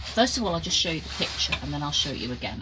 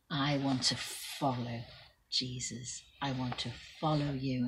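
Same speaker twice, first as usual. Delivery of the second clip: soft inner thought; 4 semitones lower, 8 dB quieter than usual. A narrator's reading. Bartimaeus thinking.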